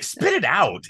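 high, sharply falling command; aggressive. Shown as a demonstration. Critic contrasting the two lines' tones, aggressive. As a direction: demand it quickly, pitched well up. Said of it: "is a very aggressive tone to have".

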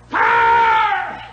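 high crying holler of fire. Retold or imitated. Old mountain preacher running down the aisle, crying holler. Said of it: "run up down the aisle and holler".

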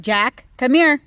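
loud firm call. Real, not acted. Mom calling her son away from the street, loud. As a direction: call him back sharply.

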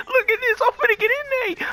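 high, giddy boast; hyped. Real, not acted. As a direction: crow about your run. Hyping own play.

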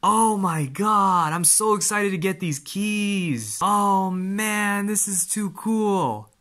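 high-pitched, gushing awe; real excitement. Real, not acted. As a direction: gush in a high, drawn-out voice. Thrilled at seeing the keys.

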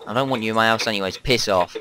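usual speaking voice

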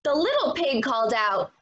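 plain reading voice

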